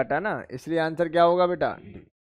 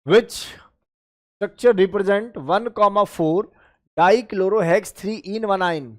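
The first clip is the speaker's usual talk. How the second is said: reading an exam question very slowly to students; much slower than usual. Shown as a demonstration. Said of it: "teacher reads the question out".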